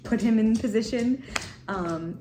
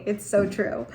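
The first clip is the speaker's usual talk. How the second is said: normal talking voice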